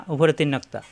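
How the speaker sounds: ordinary talk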